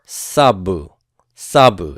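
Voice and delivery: sabu, firm accent on SA; slow sabu, falling after a stressed SA; stress on first syllable. Shown as a demonstration. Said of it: "stress that first syllable".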